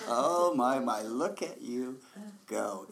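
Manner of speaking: mock admiration. Teasing her own thoughts.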